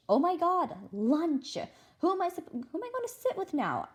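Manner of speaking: high panicked voice; rising panic, voice jumping up. Acted. Panic about lunch.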